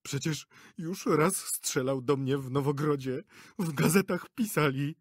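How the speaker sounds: high, tearful blubbering; sobbing. A narrator's reading. Director to actress, sobbing in fear. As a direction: weep and shake. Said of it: "zaczął szlochać".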